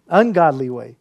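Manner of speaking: ordinary talk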